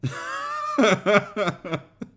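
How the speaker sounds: high, long cackle; uncontrolled high-pitched laughter; letting out a laugh. Real, not acted. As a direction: let a big laugh escape. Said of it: "let it out a wee bit".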